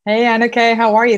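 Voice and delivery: normal talking voice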